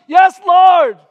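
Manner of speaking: very high, loud pleading cry; anguished cry. Retold or imitated. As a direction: cry it out, hurt. Peter's anguished third answer.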